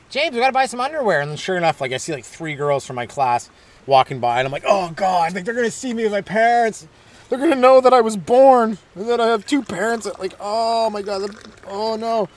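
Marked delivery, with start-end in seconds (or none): 0.1-1.2 higher-pitched mock mother; a hint of an embarrassing mom; lightly voiced mom. Imitating mom at the mall. 4.7-12.3 high, dramatic embarrassed dread; hammed-up horror, trailing into a groan; mock teen panic. Imitating his embarrassed young self.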